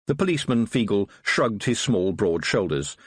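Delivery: ordinary delivery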